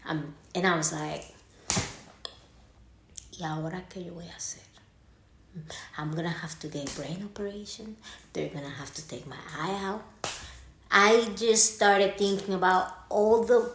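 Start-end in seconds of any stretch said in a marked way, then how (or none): 3.4-10.0 fast frantic whisper of worst cases; panicked whisper. Retold or imitated. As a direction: whisper it fast like you're spiralling. Panicked inner thoughts.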